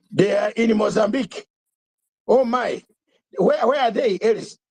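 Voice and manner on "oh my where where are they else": ordinary talk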